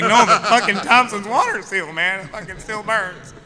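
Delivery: high, loud laughing complaint; playfully outraged about the burn; mock exasperation. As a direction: blurt it out, still stung. Retold complaint about eyes.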